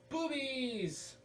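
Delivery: high, loud gleeful cry; high excited shout; excited squeal. Real, not acted. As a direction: blurt it out with delight. Excited exclamation at cover art.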